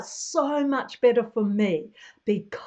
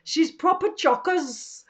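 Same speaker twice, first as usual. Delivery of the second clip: Yorkshire receptionist; 3 dB louder, slower than usual. Retold or imitated. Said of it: "I heard her say".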